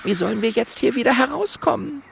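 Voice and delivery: high, helpless question; high-pitched worried critter; despairing. A narrator's reading. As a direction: ask it hopelessly in a tiny voice. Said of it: "sagt Mathilda verzweifelt".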